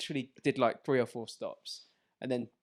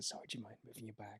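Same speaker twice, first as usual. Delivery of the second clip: soft, quick polite ask; polite request; 3 semitones lower, 17 dB quieter, faster than usual. Retold or imitated. Polite commuter asking a stranger to move bag. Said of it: "I used to be polite and say".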